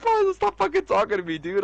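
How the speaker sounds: high-pitched, laughing mock yell at a friend; mock exasperated yell. Retelling how he told Paulo off, high laughing mock yell. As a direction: squeal it out in mock outrage through laughter. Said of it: "I was like".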